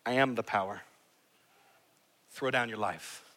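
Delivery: a deep, hushed divine command; voice of God. Acted. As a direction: speak it low and weighty, from above. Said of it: "God says".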